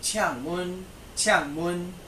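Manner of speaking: teacher models the phrase twice. Shown as a demonstration. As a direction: say it clearly so they can echo it.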